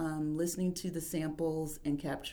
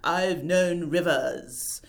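slow, louder poetic recitation; Langston Hughes reading; 3 semitones lower, 6 dB louder, much slower than usual. Retold or imitated. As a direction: recite slowly like an elder poet. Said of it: "hearing the fifty-year-old Langston Hughes is".

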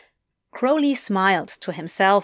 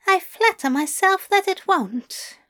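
much higher, playful angel voice; Aziraphale's voice; 9 semitones higher than usual. Acted. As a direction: pitch him up, smug and teasing.

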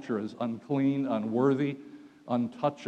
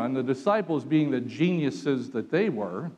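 ordinary delivery

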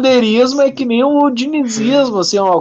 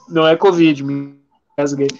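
ordinary talk, nothing marked

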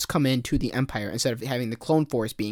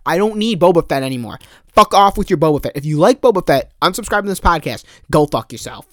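pissed off outburst; 3 semitones higher, 7 dB louder than usual. Real, not acted. Podcaster fuming at the idea of more Boba Fett, loud angry outburst. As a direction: blow up at the very idea. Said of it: "I'm pissed right now".

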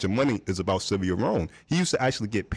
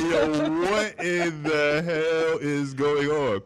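very slow, drawn-out disbelief; retold bafflement; 5 semitones higher, much slower than usual. Baffled crew look at each other, slow drawn-out disbelief. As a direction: stretch every word out, higher than usual.

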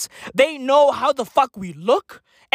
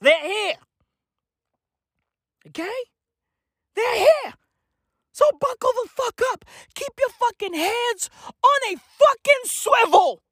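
high panicked warning; 10 semitones higher than usual. Acted. Alien panic rant.

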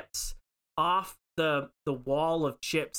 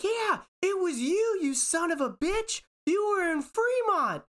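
very high, frantic shriek; hysterical accusation. Retold or imitated. Woman shrieking accusations at the cashier. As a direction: accuse him like he's a plague.

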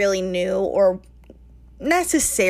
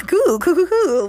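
higher, louder mock cool cool; teasing echo; 9 semitones higher, 6 dB louder than usual. Teasing her cohost's cool cool cool, louder and higher. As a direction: parrot it playfully.

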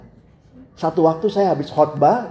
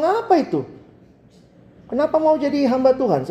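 much higher objecting parent; mock shocked father; 8 semitones higher than usual. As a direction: voice the father's indignation. Father objects to ministry calling. (ayah yang kaget, suara jauh lebih tinggi)

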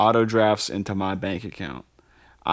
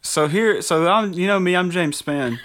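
high, loud weatherman voice; 6 semitones higher, 4 dB louder than usual. Retold or imitated. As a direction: play the folksy local forecaster. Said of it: "continues the weatherman impression".

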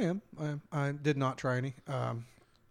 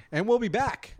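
high, loud mock show outro; big pretend podcast sign-off; 10 semitones higher, 8 dB louder, slower than usual. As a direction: belt out a pretend cliffhanger promise. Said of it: "we're just gonna be like".

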